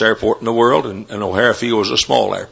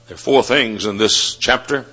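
ordinary delivery

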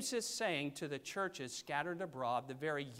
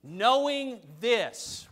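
loud, drawn-out KNOWING THIS; slow, forceful verse breakdown; broken down emphatically; 4 semitones higher, 10 dB louder, much slower than usual. Shown as a demonstration. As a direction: stretch each word out, loud and slow. Let me break that down here.

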